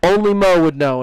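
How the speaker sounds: ordinary talk, nothing marked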